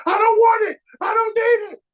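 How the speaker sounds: high, loud mock protest; imitating a patient yelling refusal; screaming patient. Sick patient refusing the doctor, high mock yell. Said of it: "Sometimes people be screaming".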